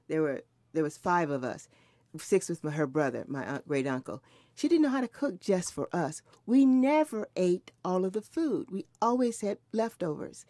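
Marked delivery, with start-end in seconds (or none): none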